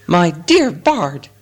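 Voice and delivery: slow, lightly squeaked protest; squeaking. A narrator's reading. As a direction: draw it out with a slight squeak. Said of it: "squeaked Bilbo".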